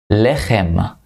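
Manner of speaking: lechem, with a throaty ch as in Hebrew; Hebrew word said slowly. Shown as a demonstration. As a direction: say lechem clearly for students to repeat. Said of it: "Lechem is bread".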